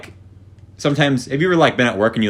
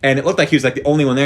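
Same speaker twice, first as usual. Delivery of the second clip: ordinary talk, nothing marked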